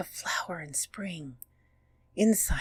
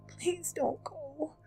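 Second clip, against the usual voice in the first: pleading; slower than usual. Acted. Friend begs to stay.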